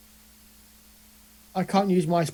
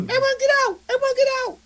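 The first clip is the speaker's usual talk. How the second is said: very high, sustained call; yelling a warning; faster than usual. Acted. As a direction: cry out to clear the building. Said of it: "and start yelling".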